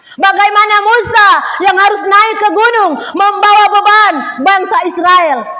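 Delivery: loud, high, driving sermon voice; preaching at full force. Acted. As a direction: preach it hard to the congregation. Preaching climax about Moses.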